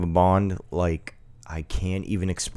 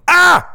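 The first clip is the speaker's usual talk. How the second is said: short, sudden cartoon yell; a louder mock "ah!"; mock scream; 5 dB louder than usual. Co-host screaming the start of a cartoon title, mock scream.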